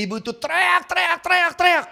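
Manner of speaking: high, pleading outcry; preacher echoing the mother's screams; preaching at full force. Acted.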